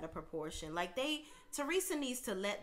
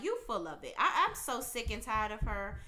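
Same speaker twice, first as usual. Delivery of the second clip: ordinary talk, nothing marked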